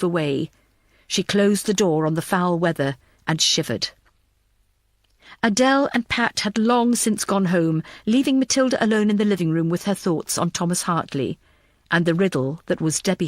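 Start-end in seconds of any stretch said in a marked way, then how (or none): none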